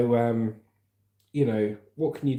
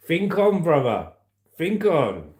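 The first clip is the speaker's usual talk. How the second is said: emphatic call out; 6 semitones higher, 6 dB louder, slower than usual. Real, not acted. Loud emphatic urging to viewers.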